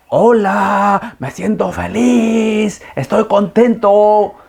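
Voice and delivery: high, slow shouted joy; mock joyful shout. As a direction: call your happiness to the mountains. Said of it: "Todos los días gritaba y gritaba". (grito feliz, alto y lento)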